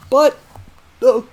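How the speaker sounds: hiccuping mid-sentence; jolting hiccup breaks. Real, not acted. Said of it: "oh god, I got the hiccups".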